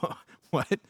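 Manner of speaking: mock stammered confusion; baffled reaction. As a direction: act out a puzzled double take. Acts out a baffled Bible reader.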